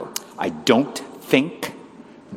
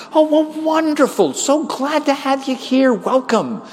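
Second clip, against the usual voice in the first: high-pitched, drawn-out delight; overdone, high-voiced welcome; gushing welcome; 14 semitones higher, slower than usual. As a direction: gush over the new arrivals. Acts out over-eager welcome to newcomers.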